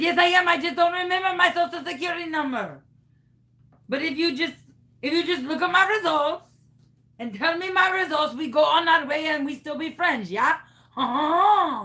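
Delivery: high, chummy French-Canadian caricature; fake Canadian accent. Caller coaxing the clerk for results, fake Canadian accent. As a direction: wheedle in a phony French accent, finishing on a fake laugh. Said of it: "What happened to your accent?".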